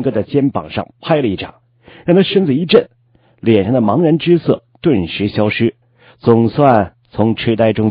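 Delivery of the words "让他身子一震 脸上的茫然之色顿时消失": ordinary delivery, nothing marked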